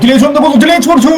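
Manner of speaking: sudden high-pitched commentary burst; urgent, high sports call; excited shot call. Real, not acted. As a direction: leap up as the shot comes. Calls a shot on goal.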